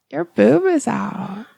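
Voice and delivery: slow, drawn-out call to a friend; imitated party-girl friend. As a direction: drag each word out, mock-alerting her. Passenger's friend points out exposed boob.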